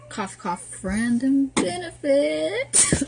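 slow, rising sing-song tease; teasing innuendo. Acted. Girlfriend joking about what "friend" means, teasing. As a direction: stretch it out high and suggestively.

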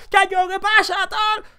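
high, put-on whiny protest; mock protesting player. Imitating Vinicius arguing with the ref in a high mocking voice. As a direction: play the moaning player. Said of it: "protestando y manoteando así".

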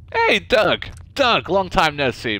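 cheerful loud greeting to Doug; loud hello. Acted. Gamer greeting companion Doug, loud and cheerful. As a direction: call out to an old friend, loud and cheerful.

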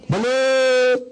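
drawn-out call of "Balot!"; balut vendor's cry. Retold or imitated. Speaker imitating the balut vendor calling in the street.

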